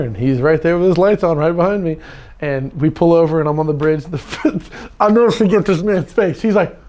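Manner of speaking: usual speaking voice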